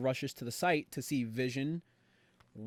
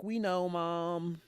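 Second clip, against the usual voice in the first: drawn-out, higher mock kid whine; whiny impatience; 4 semitones higher, much slower than usual. Kids answering Mom's warning, drawn-out mock whine. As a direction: drag it out like a nagged child.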